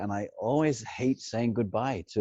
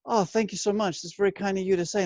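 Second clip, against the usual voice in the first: higher, sugary polite voice; higher mock-polite thank you; polite Bob; 9 semitones higher than usual. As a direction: play fake gracious. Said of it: "Bob would go".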